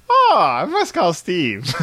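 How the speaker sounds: slow, high sarcastic delight; mock grateful. Friend retelling wasp gift, sarcastic slow delight. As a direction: fake thrilled to call the giver. Mock gratitude on finding wasps.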